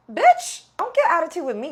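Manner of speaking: very high, loud retold outburst; indignant snapback. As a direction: shut her down with a high-pitched, explosive comeback. Acted quarrel over thrown ice.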